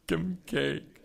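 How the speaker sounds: high, choked-up imitation of being overcome; mock overwhelmed. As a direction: act out falling apart over the honor. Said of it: "I was just overwhelmed with it".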